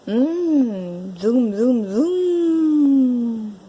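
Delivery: humming toy-motor zoom; drawn-out zoom, swelling up then gliding down; imitated engine zoom. Playing with a toy bike, imitated engine zoom.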